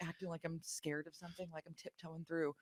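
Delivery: very soft tiptoe voice; hushed mock scared. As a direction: sneak through the words in a hush.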